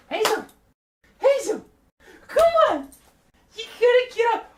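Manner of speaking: very high, loud calls to the dog; puppy-calling voice. Real, not acted. Streamer calling puppy Hazel to stand, high excited calls. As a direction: coax the dog up with squeaky cheer.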